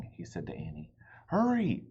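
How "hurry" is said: high, loud urgent call; high loud hurry call. Acted. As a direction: call him to hurry from behind. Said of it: "she called from behind him".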